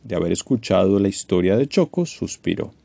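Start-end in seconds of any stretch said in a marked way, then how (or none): none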